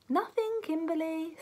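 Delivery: stifle a giggle as you say it; lightly sniggering reply. A narrator's reading. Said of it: "sniggered the boy".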